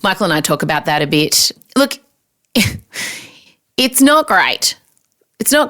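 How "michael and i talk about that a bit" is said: ordinary talk, nothing marked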